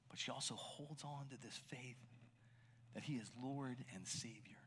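soft, near-whispered awe; hushed reverence. Real, not acted. Preacher to the congregation, in a reverent hush. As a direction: let the faith settle quietly.

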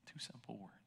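whisper. Real, not acted. Preacher to the congregation, in a reverent whisper. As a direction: breathe the words out. Hushed reflection after the verse.

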